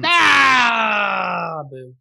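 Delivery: high, loud drawn-out jeer; mock booing. Friend protesting a stolen draft pick, loud mock booing.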